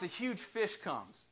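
ordinary delivery

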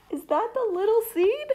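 high, quick, wondering voice; curious voice. Acted. A character at the game asks about the seed, wondering. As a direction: ask it brightly and quickly.

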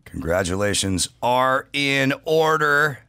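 ordinary talk, nothing marked

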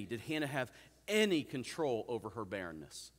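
"any" stands out, stretched and stressed. Real, not acted. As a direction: hit "any" hard, then let the rest fall away. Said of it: "I emphasize the word any".

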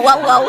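loud playful woof woof; mock dog bark. Man joking the cat now speaks dog, mock bark. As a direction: yap like a dog, laughing. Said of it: "bark at me".

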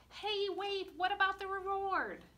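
high, loud mock protest; indignant interruption. Reader objecting that the reward was forgotten, high mock protest. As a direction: pretend you just remembered. Narrator interrupts herself in character.

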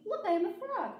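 high, proud announcement; triumphant. A narrator's reading. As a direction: crow it out proudly. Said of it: "he said triumphantly".

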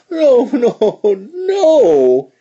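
high loud sobbing fox. A narrator's reading. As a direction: cry like a baby. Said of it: "he sobbed".

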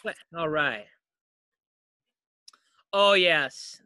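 normal talking voice

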